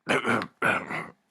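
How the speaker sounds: loud fake hacking cough; imitated cough. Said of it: "you'll know him by his cough".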